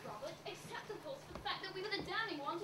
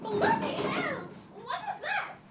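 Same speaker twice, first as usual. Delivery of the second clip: higher, much louder, drawn-out jolt of fright; frightened outburst in the dark; startled shout; 8 semitones higher, 11 dB louder, slower than usual. Acted. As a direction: jump at the sound and cry out. Startled by a noise.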